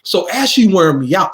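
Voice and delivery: normal talking voice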